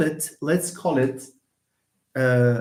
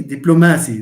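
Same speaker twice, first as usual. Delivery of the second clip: diplomacy said loudly as diplo-MA-cy; Arabic-style mispronunciation; 8 dB louder, faster than usual. Shown as a demonstration. Teacher mocks a wrong way, Arabic-like stress on MA. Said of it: "That's Arabic".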